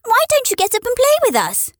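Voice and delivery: high, light animal voice; a wild rabbit inviting a friend to play; wild rabbit's voice. A narrator's reading. Wild rabbit asks the toy rabbit to play, high and light. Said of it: "one of them asked".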